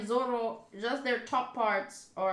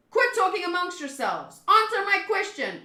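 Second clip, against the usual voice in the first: higher, louder demand; acted impatient yell; angry pirate boss; 6 semitones higher, 5 dB louder than usual. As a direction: shout it at intruders. Pirate boss ordering intruders.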